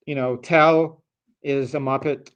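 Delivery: ordinary talk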